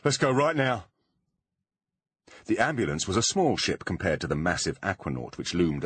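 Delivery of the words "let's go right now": higher, nervous urging; anxious and hurried. A narrator's reading. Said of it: "said Foley nervously".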